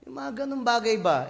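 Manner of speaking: normal talking voice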